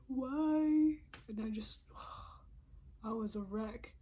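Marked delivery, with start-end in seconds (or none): 0.1-1.0 a higher, louder cry of why; anguished why. Real, not acted. Alone in her car, betrayed by her sister, anguished cry. 1.8-3.0 a much softer breathy sigh; shaky soft sigh. Real, not acted. Driving home a wreck, a soft sigh.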